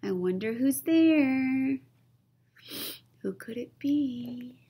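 eerie, hushed creature voice; a hint of a ghostly stranger; spooky mystery voice. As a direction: tease in a ghostly hush. Spooky voice from woods.

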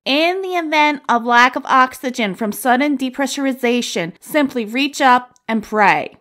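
hammed-up stewardess safety spiel. Stewardess mocking the cheap airline's safety routine, hammed-up announcement. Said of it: "she said".

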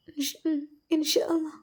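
high, breathless whispered reply through tears; barely able to get the words out; sobbing whisper. Acted. Sister clinging to her brother, whispering through sobs. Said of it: "Aishu വിതുമ്പിക്കൊണ്ട് വീണു".

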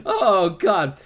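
normal talking voice

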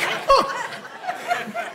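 high, soft mock gasp; taken aback. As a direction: imitate a startled onlooker, high and airless. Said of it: "you're like".

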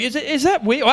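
sudden alarm at an unexpected leak; high bewildered yelp; startled confusion. Retold or imitated. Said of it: "I was actually standing there going".

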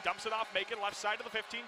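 ordinary talk

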